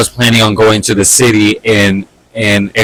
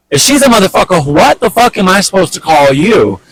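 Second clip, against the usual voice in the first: pitched much higher, hotter retort; heated challenge; 8 semitones higher than usual. Retold or imitated. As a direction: throw the question back hard. Retort during the quarrel.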